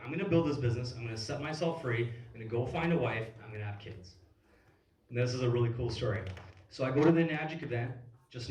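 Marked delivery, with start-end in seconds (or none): none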